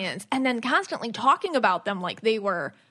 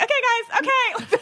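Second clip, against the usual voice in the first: much higher, laughing nervously; high nervous laugh-talk to the animals; nervous squeak; 12 semitones higher, 4 dB louder than usual. Retold or imitated. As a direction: soothe the circling sea lions, half panicking. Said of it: "And I'm like".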